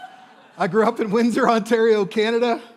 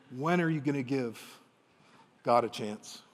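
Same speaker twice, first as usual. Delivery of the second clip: lightly voiced father, softer and tender; gentle father; 4 semitones lower, 4 dB quieter than usual. Father gently listing what his son has tried, softly tender. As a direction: lay it out kindly, not accusing.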